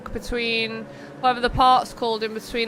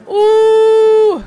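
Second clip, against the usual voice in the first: much higher, much louder long ooh; a sudden held ooh of shock at the crash; surprised ooh; 10 semitones higher, 12 dB louder than usual. Real, not acted. Commentator reacting to a racing incident with a loud ooh.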